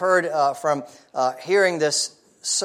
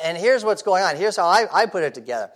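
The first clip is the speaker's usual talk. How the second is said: ordinary talk, nothing marked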